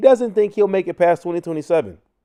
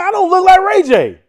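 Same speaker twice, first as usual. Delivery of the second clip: indignant denial; 6 dB louder than usual. Retold or imitated. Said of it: "I'm like".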